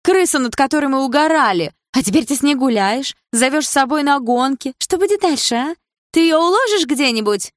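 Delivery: high, loud angry rant; outraged jealous girlfriend; jealous tirade. Acted. Jealous girlfriend rants at boyfriend.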